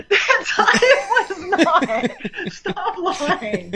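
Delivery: laughing too hard to object properly; laughing protest. Real, not acted. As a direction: protest through helpless laughter. Denies his joke while cracking up.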